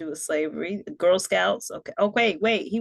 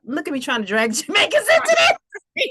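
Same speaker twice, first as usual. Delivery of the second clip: laughing; 9 semitones higher, 6 dB louder than usual. Real, not acted. Laughing at own joke.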